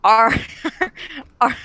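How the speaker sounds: normal talking voice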